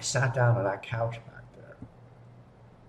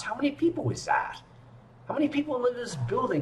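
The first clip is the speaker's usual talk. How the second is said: much higher, disbelieving squeak; retold disbelief, voice shooting up high; incredulous; 14 semitones higher, faster than usual. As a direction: ask it in shrill disbelief at the numbers.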